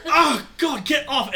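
high, loud cry to be released; disgusted protest. Acted. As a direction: push off an unwanted hug, loudly. Character fights off the bear hug.

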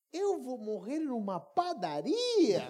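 drawn-out, swooping disbelief; incredulous. Retold or imitated. Preacher replaying his thought of dying in a bakery, incredulous. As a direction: protest the absurd fate slowly.

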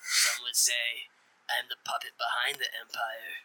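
slow, ominous boast; slow grandiose villain. Pretend. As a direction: drag it out like a puppet master.

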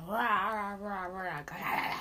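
playful monster roar; a roaring monster for the kids; mock roar. Shown as a demonstration. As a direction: rah it out like a hungry beast. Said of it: "That's roaring".

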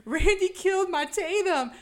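high, loud mock panic; hammed-up frantic plea. Stu pleading with Sydney about Randy, frantic. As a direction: wail the accusation, stretching the end.

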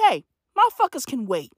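ordinary delivery

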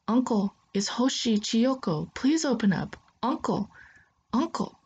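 high, urgent calling at the door. Acted. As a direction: call out to wake uncle, pitch rising.

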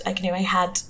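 ordinary talk, nothing marked

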